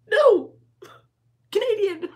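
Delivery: loud, high imitation of a husband cutting in; hammed-up shouted correction; mock exasperated interruption. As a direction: cut the chatter off with a mock shout. Husband cuts off her Scouse chatter.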